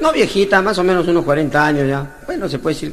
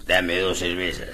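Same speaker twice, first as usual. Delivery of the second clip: put-on low, slow drunken slur; low slow drunken demand; 11 semitones lower, 7 dB quieter, slower than usual. Drunk in confession box.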